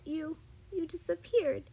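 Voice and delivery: put-on high-pitched, mildly distressed child; understated child's cry. A narrator's reading. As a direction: plead it upward, a little upset. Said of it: "she cried out more".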